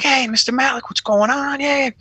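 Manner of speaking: high, bright-eyed eager hello; eager greeting. Retold or imitated. Cop greeting a movie star, high and eager. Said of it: "I'm like".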